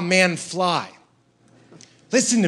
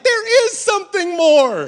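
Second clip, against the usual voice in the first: put-on much higher, breathy-free wonderstruck girl voice; dreamy believer; 11 semitones higher than usual. Comic imitating girls who love magic, high and wonderstruck. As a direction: gush it with wide-eyed wonder.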